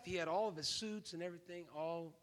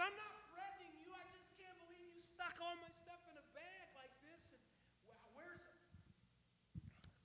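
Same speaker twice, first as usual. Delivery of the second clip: much higher, softer, nearly whispered disbelief; high exasperated protest; 13 semitones higher, 17 dB quieter than usual. Retold or imitated. Big guest tells the clerk he is not threatening, high and breathy. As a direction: squeeze it out high and breathless, baffled.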